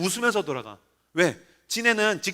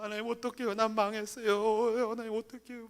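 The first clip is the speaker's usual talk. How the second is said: acted crying prayer; 2 semitones higher, 12 dB quieter than usual. Pastor alone in dark chapel crying to God, faint sobbing. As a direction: wail it weakly as if in tears. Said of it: "막 찬양하다가 울다가".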